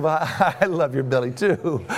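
amused comeback with a laugh breaking in; laughing retold reply. Dad answers daughter's belly compliment, retold with a laugh. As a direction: chuckle through the words.

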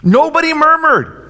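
"Nobody murmured." said high and loud; high, loud statement. Shown as a demonstration. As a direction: preach "Nobody murmured." at full force. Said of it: "Nobody complained".

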